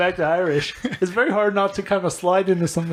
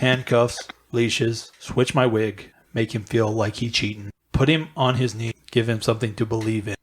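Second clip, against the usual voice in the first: lower, deadpan droning read; Ben Stein; 5 semitones lower than usual. Pretend. Reading rap lyrics on request, low deadpan drone. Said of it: "hear Ben Stein read this out".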